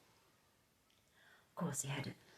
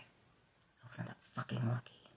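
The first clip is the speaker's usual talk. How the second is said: ordinary delivery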